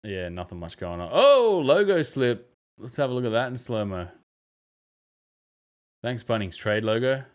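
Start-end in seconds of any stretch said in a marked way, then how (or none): 1.1-2.5 high, loud amused exclaim; delighted oh. Real, not acted. Commentator gleefully spotting a player slip on the logo, high and loud. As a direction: pounce on the blooper gleefully.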